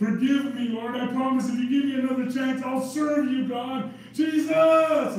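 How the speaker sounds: beg, then scream Jesus from hell; desperate plea from hell. Acted. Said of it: "people in hell are saying".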